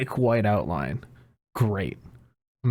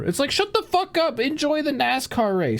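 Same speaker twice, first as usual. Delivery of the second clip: exasperated outburst. Real, not acted. Podcaster fed up with Twitter fans, high exasperated snap. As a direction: shut the fuck up, pitched way up and exasperated.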